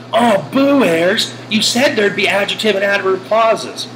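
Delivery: boo the teacher like a let-down student; mock student complaint. Students protesting a trick question to Ayers, mock complaint.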